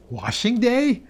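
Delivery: puzzled. Acted. Puzzled about the smell.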